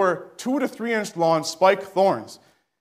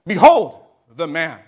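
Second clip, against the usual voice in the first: Pilate's proclamation; 6 dB louder than usual. Acted. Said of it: "And he said".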